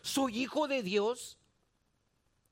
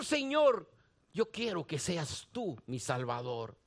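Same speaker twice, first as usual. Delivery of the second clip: soft quiet prayer; 7 semitones lower, 5 dB quieter than usual. Pretend. A believer praying to the Lord for salvation, soft and humble. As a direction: lower and softer, a humble plea to the Lord.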